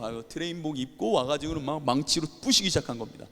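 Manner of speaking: ordinary talk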